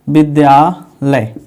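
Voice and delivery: विद्यालय said slowly, stretched into its parts; word drawn out. Shown as a demonstration. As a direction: draw out विद्यालय at half speed, part by part. Repeats word to show its parts. (विद्यालय को धीरे-धीरे खींचकर)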